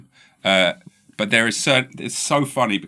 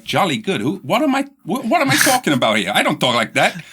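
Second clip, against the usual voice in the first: high-pitched, animated imitation of a baffled dad; comic self-startled exclaiming; mock bewilderment; 9 semitones higher, 5 dB louder than usual. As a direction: catch yourself, loudly aghast at your own words. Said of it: "he'd actually stop himself and be like".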